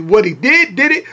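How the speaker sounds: ordinary talk, nothing marked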